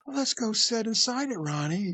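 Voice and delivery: high creepy invitation, dropping on the name; South Park creep. Retold or imitated. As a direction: coax like a cartoon creep. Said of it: "reminded me of the creeps on South Park".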